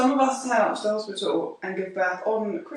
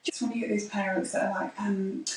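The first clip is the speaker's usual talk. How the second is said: ordinary delivery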